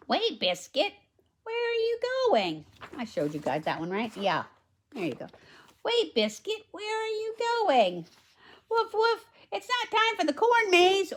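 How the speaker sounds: put-on bright, high read-aloud voice for the little girl; high storybook girl. Reads girl and puppy.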